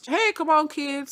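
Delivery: mock pastor rounding up kids; calling the kids.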